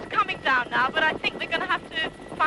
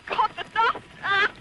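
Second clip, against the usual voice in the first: ordinary talk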